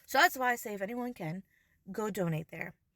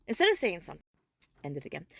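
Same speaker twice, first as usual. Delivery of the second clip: ordinary talk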